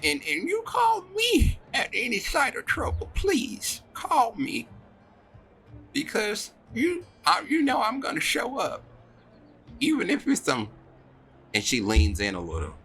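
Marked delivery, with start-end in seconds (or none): 0.0-10.7 high-pitched, halting motherly voice; emotional mum sending a child off to school; fretting mother. Acted. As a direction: urge your child tearfully, voice pitched way up. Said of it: "she clears her eyes and she's just like".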